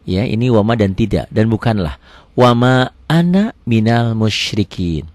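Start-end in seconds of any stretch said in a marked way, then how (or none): 2.3-5.0 measured, drawn-out teaching recitation; slow recitation. Shown as a demonstration. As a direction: recite it slowly for learners to follow. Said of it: "perlahan ya".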